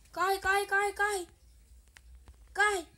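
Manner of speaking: panicked high yelling; frantic shouts to run; being chased. Real, not acted. Fleeing a skeleton in game.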